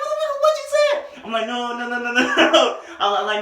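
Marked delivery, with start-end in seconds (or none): 0.0-1.2 very high-pitched screech, loud at the end; mock outraged girl. Girl confronts him over his poem, high mock outrage. Girl repeats her angry challenge. 1.5-2.8 higher rapid string of denials, getting louder; mock panicked backpedalling. Poet backpedals to the angry girl, mock panic.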